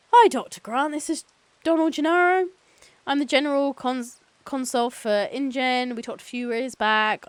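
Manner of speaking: high, loud phone-call pleasantries; chipper company lawyer. Acted. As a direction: play an eager corporate counsel introducing himself. Voices Gennaro on the phone.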